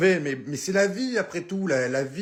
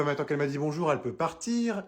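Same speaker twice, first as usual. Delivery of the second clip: ordinary talk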